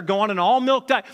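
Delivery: normal talking voice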